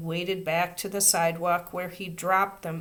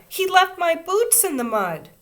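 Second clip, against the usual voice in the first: high, loud complaint; upset; 12 semitones higher, 8 dB louder than usual. A narrator's reading. Girl complaining to the teacher, upset. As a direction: complain with dismay. Child upset over boots.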